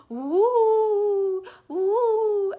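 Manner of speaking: loud, high, long "woo"; urgent swooping wind noise; imitated wind howl. Shown as a demonstration. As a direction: howl like a snowstorm at full volume. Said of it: "he tries to imitate the sound".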